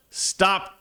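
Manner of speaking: high, abrupt mock yell; retold yell. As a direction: call out a contained "stop". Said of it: "I just yelled".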